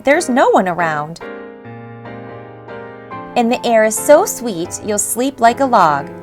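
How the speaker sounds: plain reading voice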